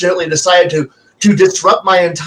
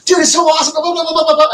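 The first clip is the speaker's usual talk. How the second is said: mock eager gamer; 9 semitones higher, much faster than usual. Mocking overeager players.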